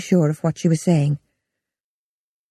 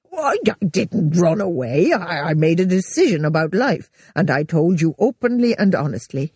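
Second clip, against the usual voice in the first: slight bluster. A narrator's reading. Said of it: "he blustered slightly".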